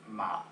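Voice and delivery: MA dropped low and cut short; low dot tone. Shown as a demonstration. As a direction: drop MA down briefly, low in the voice. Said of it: "with the point here".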